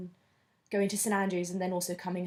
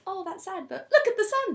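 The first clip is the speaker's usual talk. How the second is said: much higher, ending loud and gleeful; sunny cheer; 12 semitones higher, 8 dB louder than usual. Retold or imitated. Imitating her sun-happy Toulouse self, bright and gleeful. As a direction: shrug off bad news, beam at the sunshine. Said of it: "I would still be like".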